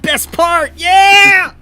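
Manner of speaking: high, loud, thrilled yell; excited shout. Acted. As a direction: cheer it out loud.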